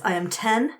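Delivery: normal talking voice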